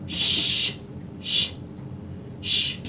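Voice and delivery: repeated voiceless shushing; soothing shush. Real, not acted. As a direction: hush a restless dog. Hushing the dog.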